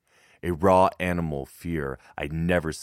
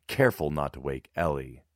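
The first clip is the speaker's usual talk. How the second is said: usual speaking voice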